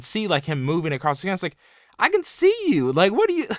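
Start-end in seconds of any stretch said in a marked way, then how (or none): 2.0-3.5 much higher and louder, teasing sing-song; mock villain taunt. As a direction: jeer at him like a cartoon villain. Mocking Mantis taunting slow Flash.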